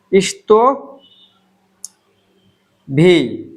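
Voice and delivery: ইস্তো ভি said slowly, syllable by syllable; slow Russian word. Shown as a demonstration. Teacher drills Russian pronoun for students, slow and clear. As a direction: spell out ইস্তো then ভি with a pause. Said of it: "তো ইস্তো ভি".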